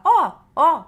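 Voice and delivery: put-on high, loud little child's "ó, ó"; child pointing. Child pointing at a mole, calling out. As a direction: play the child eagerly pointing. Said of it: "aponta numa pinta sua e fala".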